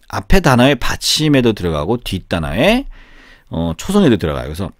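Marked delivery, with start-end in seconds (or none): none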